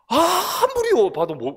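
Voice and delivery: 아무리 drawn out long, high and loud; exasperated bafflement. Retold or imitated. Pastor recounting a baffling dialect test, exasperated bafflement.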